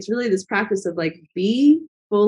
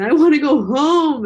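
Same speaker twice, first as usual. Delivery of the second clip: raised and louder, a half-acted teary wail; retold crying plea; 7 semitones higher, 6 dB louder than usual. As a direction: replay the breakdown lightly, as a homesick plea. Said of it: "breaking down in the garden and just crying".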